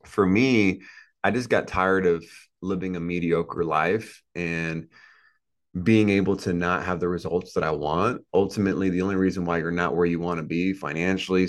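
ordinary delivery

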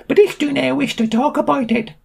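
very high screechy milk seller; indignant high character voice; Frau Milk, cross. A narrator's reading. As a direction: refuse in a high, piercing squeal. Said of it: "She looked angrily at him".

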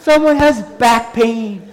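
high, loud, drawn-out call. Retold or imitated. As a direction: call it slowly over a crowd. Repeating the announcement to the crowd.

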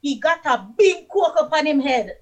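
"big" said loud and large; stress on big. Shown as a demonstration. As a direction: make the word "big" sound big. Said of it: "make the big sound big".

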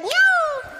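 very high, drawn "ñau"; high-pitched whizzing sound effect; imitated camera zap. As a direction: squeal out a camera flash sound. Imitates second camera flash.

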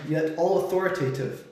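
ordinary delivery

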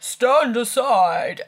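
loud, drawn-out porter's call. A narrator's reading. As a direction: bellow it slowly to clear the way. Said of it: "calling out".

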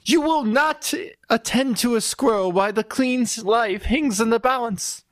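high, indignant scolding. Acted. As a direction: scold him, pitched high.

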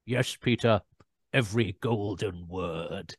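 low, slow, deadpan put-down; sarcastic reply to Peter, low and slow; dry sarcasm. Retold or imitated. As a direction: flatly humour the pompous actor. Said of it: "Terrance just went".

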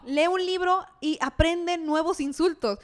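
mock scolding. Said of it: "scornful advice to insulter".

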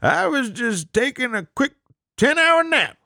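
high, silly excuse voice; high mock voice. Voicing James excuse.

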